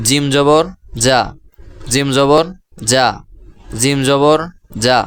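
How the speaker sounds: জিম জবর যা chanted as a drill; teaching pronunciation. Shown as a demonstration. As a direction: model যা for the class. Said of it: "এর পরবর্তী অক্ষর".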